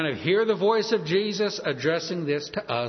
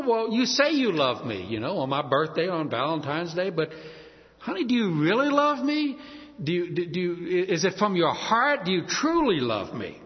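wife questioning husband. Acted. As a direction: plead for proof like a doubting wife. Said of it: "a question a wife might ask her husband".

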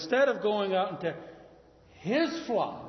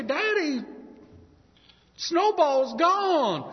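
put-on high, slow, distressed child voice; a little kid breaking bad news; upset child; 11 semitones higher, 6 dB louder, much slower than usual. Child telling Daddy the lamb is gone, high and upset.

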